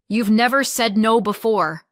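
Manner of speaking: loud, cutting retort; sharp snap. Acted. Sister snaps back during the phone argument, sharp and loud. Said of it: "she snapped, suddenly sharp".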